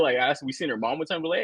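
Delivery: ordinary delivery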